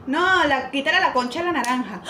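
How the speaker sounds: put-on quick burst of Argentine slang accent. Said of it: "no se me va a pegar".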